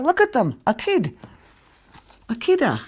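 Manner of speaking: put-on high-pitched old-lady exclamations; old ladies exclaiming. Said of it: "Then their old ladies would say".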